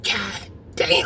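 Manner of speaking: breathless whisper of disbelief; whispered god damn. Retold or imitated. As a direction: whisper it under your breath. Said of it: "I'm like".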